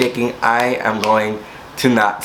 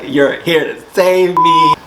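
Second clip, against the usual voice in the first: gushing relief; 6 semitones higher, 4 dB louder, slower than usual. Retold or imitated. Hugging her rescuer.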